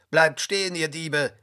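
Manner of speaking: heated, forceful shouting in a story; angry. A narrator's reading. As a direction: call out the thieves angrily. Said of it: "rief er zornig".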